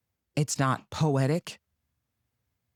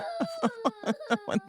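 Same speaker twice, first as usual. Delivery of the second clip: mock sob wail; 5 dB quieter than usual. Mock wailing after fake crying.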